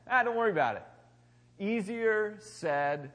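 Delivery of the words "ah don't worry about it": louder, higher, breezy dismissal; breezy brush-off. Retold or imitated. Pastor mimics brushing off a friend's huge problem, breezy and louder. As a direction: toss it off lightly, as if nothing's wrong. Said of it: "You're like".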